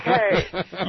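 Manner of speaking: okay through a laugh. Retold or imitated. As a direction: say it while cracking up. Reply while laughing.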